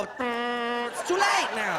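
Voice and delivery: very slow, loud drawn-out wail; mock wife wail. Wife wailing after the crash, slow loud mock lament. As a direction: drag it out like a scolding wife.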